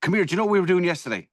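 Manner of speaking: keen to share yesterday's story; excited. Real, not acted. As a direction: burst in eager to tell. Eager to start a story.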